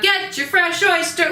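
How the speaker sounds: high-pitched market call; vendor calling out. Retold or imitated. Teacher imitating a Paris street seller calling out oysters. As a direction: call out your oysters to the crowd. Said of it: "they're yelling out".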